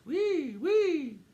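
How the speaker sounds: high loud siren call; siren wee. A narrator's reading. Mouse shooting into the elevator, high siren. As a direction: wail like a toy siren.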